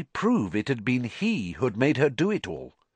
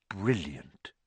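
soft, low and hushed; 4 semitones lower, 6 dB quieter than usual. Acted. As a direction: breathe it out softly. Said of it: "he said softly".

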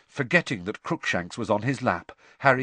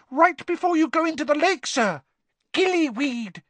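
squeaky house elf. Acted. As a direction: squeak it out urgently. Dobby voiced.

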